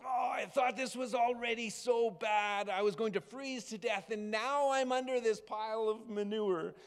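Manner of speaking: high, woeful little bird complaint. Acted. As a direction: whine it like a sorry little bird under a pile. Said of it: "the little bird says".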